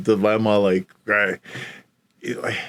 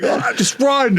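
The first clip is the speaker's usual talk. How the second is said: urgent; 3 dB louder, slower than usual. Retold or imitated. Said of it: "he's like".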